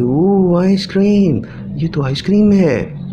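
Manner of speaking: put-on high and loud, a child amazed by ice cream; poor girl at the restaurant window, amazed at the ice cream; little girl's wonder. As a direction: play the hungry girl gasping at the ice cream. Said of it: "तो उसको देख के कहती है".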